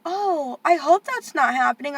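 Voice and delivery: airy pretend concern; mock caseworker. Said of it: "they're like".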